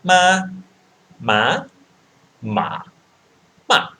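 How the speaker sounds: ma, ma, ma, ma: high level, rising, dipping, falling tones; four Mandarin tones. Shown as a demonstration. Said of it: "so it goes like this".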